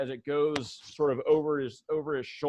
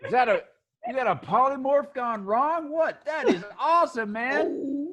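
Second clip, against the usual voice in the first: higher, excited dude voice; amazed tattoo fan, higher and quicker; impressed shop guy; 7 semitones higher than usual. Acted. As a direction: gush over the weird customer. NPC Kip in awe.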